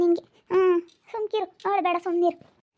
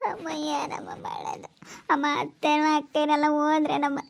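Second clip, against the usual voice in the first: tearful plea; 5 dB quieter than usual. Acted. Distressed daughter pleading to mother.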